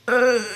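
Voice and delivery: loud, weary groan. Retold or imitated. Mother hears child on baby monitor, loud acted groan. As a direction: groan at an unwelcome early wake-up. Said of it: "and I'm like".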